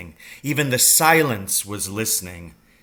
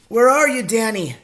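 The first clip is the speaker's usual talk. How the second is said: high, loud searching call; 11 semitones higher, 7 dB louder than usual. A narrator's reading. Father calls for his son in the wood, calling out. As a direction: shout across a distance. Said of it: "my father called out".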